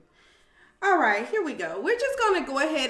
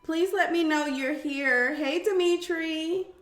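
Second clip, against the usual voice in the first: work voice; 6 semitones higher than usual. Real, not acted. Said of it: "I got my work voice on today".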